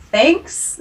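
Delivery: mock-grateful; sarcastic. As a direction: offer thanks you clearly don't mean.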